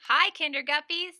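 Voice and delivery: usual speaking voice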